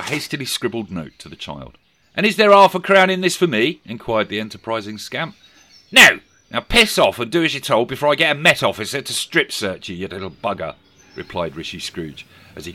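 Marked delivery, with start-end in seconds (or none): 2.2-3.8 higher, louder, cheeky ask; cheeky boy. Acted. Boy asking Scrooge for a tip, cheeky. 5.9-10.8 louder, higher barked order; rude dismissal. Acted. Scrooge telling the boy to clear off, louder.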